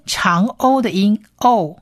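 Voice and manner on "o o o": "O" held as a long vowel, repeated with pauses. Shown as a demonstration. Teacher modelling the long Hebrew vowel for learners, long O. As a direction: say "O" long and even, spaced out. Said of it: "是念O， 长O的音".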